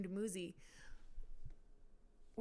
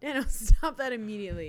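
mock scolding; 13 dB louder than usual. Real, not acted. Telling Dano off about the chipped mug, loud mock scolding.

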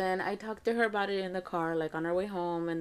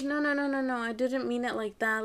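high, protesting string of no's; pleading denial with raised pitch; retold backtracking; 4 semitones higher than usual. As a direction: imitate a daughter hastily taking it back. Daughter hurriedly backtracks in retold quarrel.

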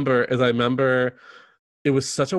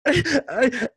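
high, overwrought fake sob voice; mock crying actress; 11 semitones higher, 4 dB louder than usual. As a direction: ham up a weepy breakup line, pitched way up and louder. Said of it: "she's, like, crying".

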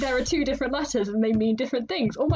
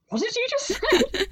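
mock puzzled friend, pitched much higher; confused friend; 12 semitones higher than usual. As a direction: play the baffled friend, lightly. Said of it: "just looking at me and just going".

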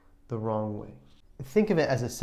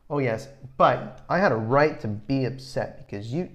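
defensive retort; 2 semitones higher, 6 dB louder than usual. Acted. Worst response to an apology.

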